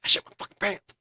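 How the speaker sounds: high, strained mock outburst; retold exasperated repeat. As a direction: repeat it with comic desperation on "fucking pants". Repeats confession after her What.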